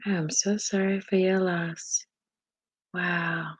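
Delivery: soft, sincere sympathy; hushed, heartfelt consoling; tender condolence. Real, not acted. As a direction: offer sympathy quietly, letting it sink in. Condolence to grieving viewer.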